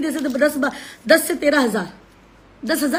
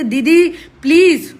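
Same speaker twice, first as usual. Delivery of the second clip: slow, high, louder begging plea; pleading girl; 4 semitones higher, 6 dB louder, slower than usual. Retold or imitated. Retelling the girl who begged her, imitating a slow pleading plea. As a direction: beg with folded hands, dragging it out. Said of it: "उस लड़की ने हाथ जोड़े थे".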